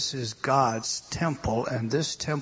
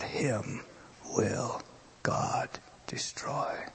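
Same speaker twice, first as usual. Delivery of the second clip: slow whisper; 4 semitones lower, 10 dB quieter, much slower than usual. Real, not acted. Preacher whispering a warning to the church. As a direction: whisper it slowly and softer.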